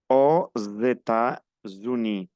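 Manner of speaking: Aux États-Unis said slowly, linked as one word; liaison demo. Shown as a demonstration. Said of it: "aquí también uno". (Aux États-Unis lento y unido)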